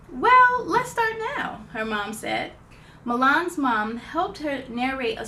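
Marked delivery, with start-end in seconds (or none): 0.1-1.7 bright, higher, louder start; eager mom. Acted. Mom encouraging Milan to start writing, eager. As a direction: cheer her on to begin.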